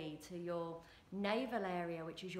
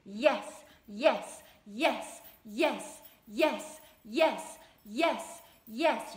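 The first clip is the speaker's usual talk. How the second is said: rapid, loud "yes, yes" chant, one per beat; fast shouted yes; 2 semitones higher, 13 dB louder, much slower than usual. Shown as a demonstration. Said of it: "let's go a little faster".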